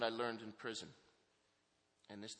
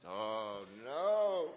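dismayed cry; 10 dB louder than usual. Retold or imitated. Telling the director he won't go, a loud dismayed cry. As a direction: wail it like the worst news yet. Learning he must move to Integrity.

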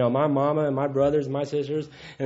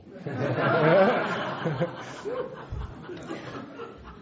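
long rising real laugh, higher; 4 semitones higher, 3 dB quieter than usual. Real, not acted. Speaker laughing to the crowd before telling the story, a long rising laugh. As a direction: let a real laugh roll out and climb.

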